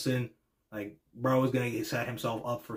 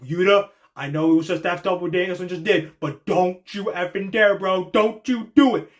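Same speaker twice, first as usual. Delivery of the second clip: higher, louder threatening warning; angry fan warning; 5 semitones higher, 6 dB louder than usual. Retold or imitated. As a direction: warn him off, rising to a yell. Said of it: "I already see people being like".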